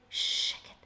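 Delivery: a hushed whisper; whispered שקט. Shown as a demonstration. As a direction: whisper it as if shushing someone. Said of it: "you would say when you do like this".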